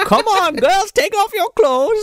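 high, drawn-out mock come-on; put-on character voice. Imitating a film woman luring girls to undress, high put-on voice.

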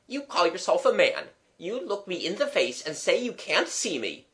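high, challenging maidservant; indignant Eliza. Acted. Eliza daring her gentleman friend to face her, indignant.

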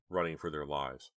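plain reading voice

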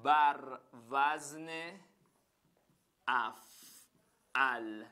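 very slow, drawn-out dictation pace; teacher dictating word by word. Shown as a demonstration. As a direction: spell it out slowly for copying.

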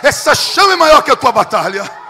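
loud, slow, soaring preacher's cry with each line hammered; preaching at full force. Real, not acted. Pastor crying out to the congregation, preaching at full force. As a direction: drive every phrase home like a fiery revival preacher.

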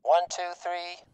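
through a megaphone; tinny bullhorn sound; megaphone effect. Shown as a demonstration. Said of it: "one from the bank of megaphones".

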